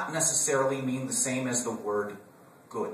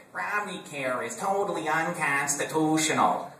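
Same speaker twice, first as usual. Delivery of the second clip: a hint of a Wisconsin accent, a touch higher and slow; 6 semitones higher than usual. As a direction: lightly do Bachmann's Wisconsin accent, drawn out. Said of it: "so I can do that accent".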